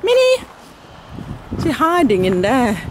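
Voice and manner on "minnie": high, loud sing-song call to a cat. Real, not acted. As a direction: call her name up high across the garden. Calling the cat in garden.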